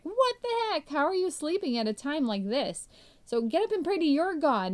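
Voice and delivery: high, startled disbelief at a sleeper; incredulous captain. Retold or imitated. Captain shaking Jonah awake, high and incredulous. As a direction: yank him awake with shock.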